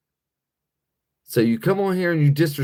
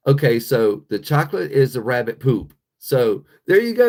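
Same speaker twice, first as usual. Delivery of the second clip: ordinary talk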